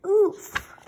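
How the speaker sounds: winded grunt, high and loud; knocked flat, the air punched out; a sharp pained oof. Acted. Character knocked over.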